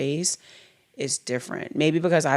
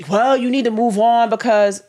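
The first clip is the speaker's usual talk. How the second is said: dismissive mock quote; 7 semitones higher, 5 dB louder than usual. Wife mimics the dismissive husband.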